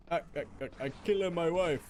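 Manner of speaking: hammed-up Borat. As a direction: joke in a Borat accent. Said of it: "Borat posting".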